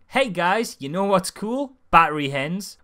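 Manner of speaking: mock enthusiastic pitch. Film pitching factory farming to its audience, mock enthusiasm. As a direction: sell it with hammed-up cheer. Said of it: "clearly the film is not going".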